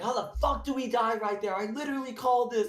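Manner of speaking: high-pitched venting at the game; exasperated yell, aimed at nobody; retold game yelling. Gamer explaining he yelled about the situation, high exasperated yell. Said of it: "I was yelling about the situation".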